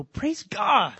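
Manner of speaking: loud, high exclamation imitating a pastor; mock-exultant cry, pitched well up; Bob. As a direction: burst out with it like an exultant preacher. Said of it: "he would look at you and go".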